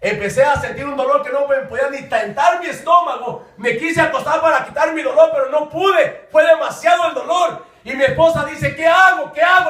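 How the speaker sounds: high, pressing storytelling from the pulpit; fervent testimony preaching. Retold or imitated. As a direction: push the story forward with force. Preaches his own testimony.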